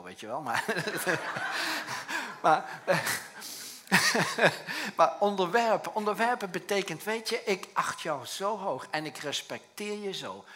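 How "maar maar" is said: loud, bursting laugh; real laughter. Real, not acted. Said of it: "laughs at own joke". (luid, hoog lachen)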